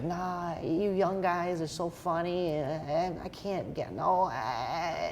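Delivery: high, soft, slow comedian impression; mumbly mock celebrity begging off; Adam Sandler. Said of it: "my terrible Adam Sandler impression".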